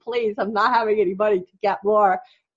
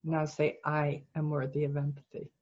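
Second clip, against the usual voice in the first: soft, slightly lowered guiding voice; guided meditation; 3 semitones lower, 5 dB quieter than usual. Real, not acted. Coach guiding client through self-empathy exercise, soft meditation voice. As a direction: guide them gently inward, softly.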